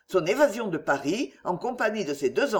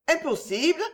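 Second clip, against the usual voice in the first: high, rising disbelief; pitched right up in astonishment; surprised; 9 semitones higher than usual. A narrator's reading. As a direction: echo it as a startled question. Countess reacts in disbelief.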